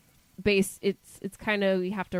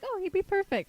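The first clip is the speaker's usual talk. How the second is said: much higher, bright excited quote; 14 semitones higher than usual. Retold or imitated. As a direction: gush about the perfect friend. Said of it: "So I'm like".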